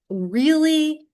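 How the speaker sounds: high, incredulous really. Real, not acted. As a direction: scoff in disbelief. Teacher's incredulous reaction.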